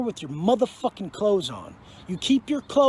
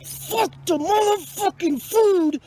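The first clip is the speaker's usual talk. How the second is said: explosive outburst; 8 semitones higher, slower than usual. Real, not acted. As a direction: spit each word out, drawn long. Explosive prison rant.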